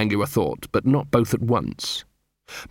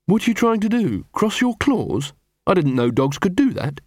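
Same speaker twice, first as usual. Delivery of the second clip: incredulous werewolf; 7 semitones higher than usual. Acted. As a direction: question it high, in disbelief. Angua's incredulous question.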